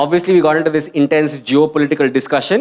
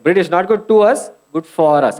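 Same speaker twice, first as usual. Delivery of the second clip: ordinary delivery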